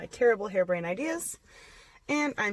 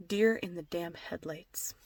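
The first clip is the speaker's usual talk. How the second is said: slow whisper; 2 semitones lower, 7 dB quieter, slower than usual. Real, not acted. Wife joking about husband's blank stare.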